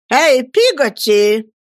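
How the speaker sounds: loud, slow call down the hall. Acted. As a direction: Miss Betsey summoning the maid, shouting. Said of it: "крикнула мисс Бетси". (громко окликая)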